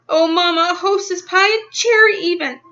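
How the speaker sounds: delighted. Acted. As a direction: squeal at the treat. Delighted by the pie.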